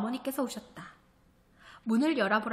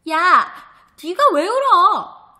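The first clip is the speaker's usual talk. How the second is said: angry yell; 7 semitones higher, 12 dB louder, slower than usual. Acted. Sister yells at crying brother.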